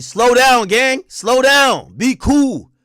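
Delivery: loud, high yell to slow down; shouting at the driver to slow the car down; urgent shout. Retold or imitated. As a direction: bark the order over the noise.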